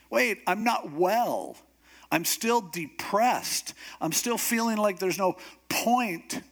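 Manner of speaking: slow, high-pitched mock whine; hammed-up plaintive objection; whiny protest. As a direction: drag it out like a sorry complainer. Elijah protests he is still depressed.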